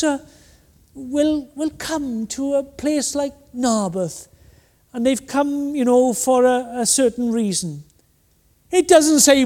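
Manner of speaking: usual speaking voice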